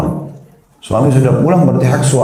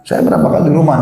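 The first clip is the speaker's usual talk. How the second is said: usual speaking voice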